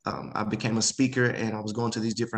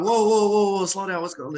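surprised; 9 semitones higher, 5 dB louder than usual. Retold or imitated. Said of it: "I'm like".

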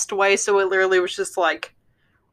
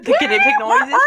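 laughing; 4 dB louder than usual. Real, not acted. Friend laughs at missed noises.

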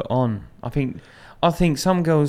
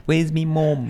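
raised northern-accent imitation; mock northern accent; 5 semitones higher, slower than usual. Mocking their weird sayings, northern accent. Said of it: "say weird things like".

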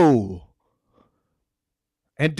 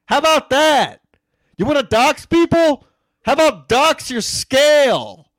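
loud, high jeering taunt; mocking taunt; 8 semitones higher, 5 dB louder, slower than usual. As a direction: jeer at her like a schoolyard bully. Taunting Bex about weight.

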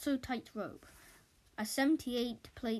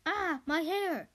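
slow, higher yelp; mock cry of pain; 7 semitones higher, 4 dB louder, slower than usual. Andy crying out as his hair gets caught, hammed-up. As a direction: stretch out a startled yelp.

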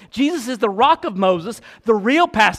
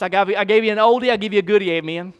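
ordinary delivery

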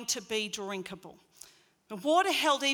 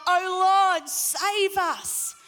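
high, loud plea over the crowd; crowd crying out; 7 semitones higher, 7 dB louder, much slower than usual. Retold or imitated. Thousands at the feast begging God, loud high plea. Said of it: "Thousands of people crying out to God".

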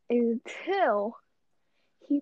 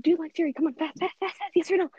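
higher-pitched, urgent retold prodding; pressing for answer; 4 semitones higher, faster than usual. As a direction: push for a quick yes or no.

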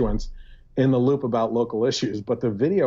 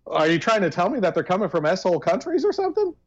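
mock outrage; 8 semitones higher than usual.

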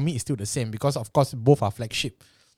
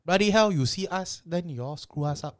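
mock Chinese Singaporean accent; 6 semitones higher than usual. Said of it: "are you doing the Chinese thing".

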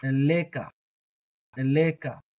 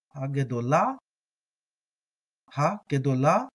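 normal talking voice

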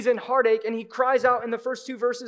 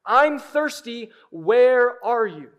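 crying out; 2 semitones higher, 9 dB louder, much slower than usual. Acted. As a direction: let the plea break open, stretched out. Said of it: "he's crying out to God".

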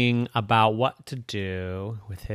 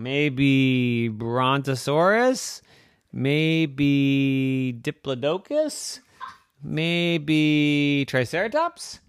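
slow pondering; 4 semitones higher, 3 dB louder, much slower than usual. Acted. Pondering slowly.

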